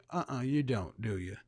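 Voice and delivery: low taunt. Real, not acted.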